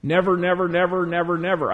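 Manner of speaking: repeated stressed "never"; emphatic nevers. Shown as a demonstration. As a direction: press each "never" and let it fade. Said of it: "it's the emphatic case".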